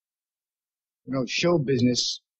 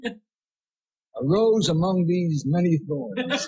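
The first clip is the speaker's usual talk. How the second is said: mock grand; 4 semitones higher, much slower than usual.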